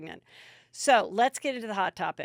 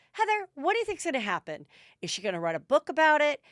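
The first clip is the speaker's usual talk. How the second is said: fast, higher-pitched pestering questions; imitated eager questions; 6 semitones higher, 3 dB louder, faster than usual. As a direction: fire off questions like curious fans. Voicing listeners' eager questions.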